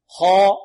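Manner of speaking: loud, full, heavy vowel on "خاء" with unrounded lips; heavy tafkhim. Shown as a demonstration. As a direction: hold "خاء" thick and loud, lips not rounded. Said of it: "بدون ضم الشفتين". (تفخيم "خاء" بدون ضم الشفتين)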